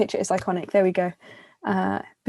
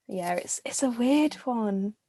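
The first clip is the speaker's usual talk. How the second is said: ordinary talk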